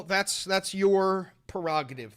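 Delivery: normal talking voice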